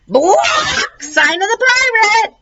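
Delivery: high slow squawking shout; parrot shriek. Acted. Parrot squawks the clue at Judy and Stink, high.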